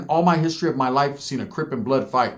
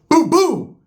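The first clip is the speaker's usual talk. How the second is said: loud, high boom boom imitating blows; punch sounds; 12 semitones higher, 7 dB louder than usual. Storyteller voicing his one-two punch in loud high booms. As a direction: smack out each boom like a punch landing.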